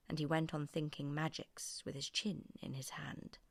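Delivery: usual speaking voice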